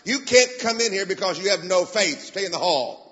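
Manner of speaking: a retold stern rebuke, pushed loud; stern command. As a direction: order her out into the hall. Telling the wife to stay out.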